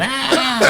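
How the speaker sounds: high-pitched comic bawl; mock wail, pitched far up; imitated crying wail. Retelling how he started crying again, comically wailing. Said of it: "I started crying again".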